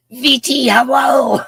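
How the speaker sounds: high, loud hello; calling out. Real, not acted. Host greets a viewer after the gum slip, calling out high. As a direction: belt out the initials and the greeting.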